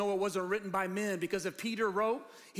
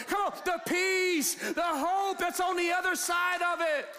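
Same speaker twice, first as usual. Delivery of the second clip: yelling; 7 semitones higher than usual. Real, not acted. Pastor preaching the joy beyond the cross, yelling. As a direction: preach it with rising fire. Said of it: "Got me yelling up here".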